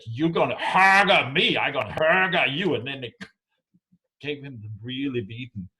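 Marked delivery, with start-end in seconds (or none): 0.1-2.7 loud rough troll challenge; threatening male trolls. Retold or imitated. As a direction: growl it like a rival troll. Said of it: "So they came and said".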